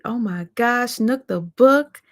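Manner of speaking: ordinary talk, nothing marked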